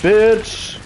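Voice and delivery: yell. Real, not acted. Yelling at survivor in game.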